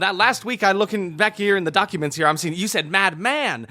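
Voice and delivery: booming announcer, high and bright. Acted. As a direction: play it like a radio announcer. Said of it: "This is my announcer voice".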